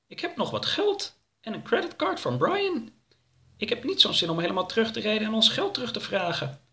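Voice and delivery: high, light cartoon voice coaxing his friend; a high-pitched sidekick talking his pal round; high cartoon voice offering to let it go; Oroka character voice.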